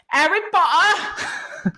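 high, drawn-out mock British outburst; horrible fake British accent. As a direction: burst out in bad fake British. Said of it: "babbling in this horrible fake British accent".

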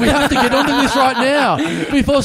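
high, loud frantic urgency; mock urgent. Gym member urgently pleading, like a hostage movie, about the backpack. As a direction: play it like a kidnap thriller.

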